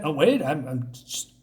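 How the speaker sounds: ordinary talk, nothing marked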